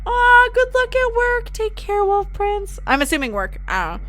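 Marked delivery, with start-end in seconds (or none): 0.0-2.8 high, drawn-out cooing send-off; affectionate farewell. Real, not acted. Streamer wishing Wolf Prince well at work, affectionately. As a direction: coo it up high, starting long on "Aw".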